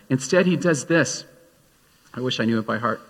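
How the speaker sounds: normal talking voice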